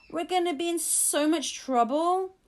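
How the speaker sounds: put-on boy sure he will be punished; worried. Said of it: "twisting his hands".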